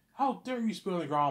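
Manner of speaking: imitated furious teacher; mock teacher yelling. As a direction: scold like an outraged teacher. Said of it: "she's like".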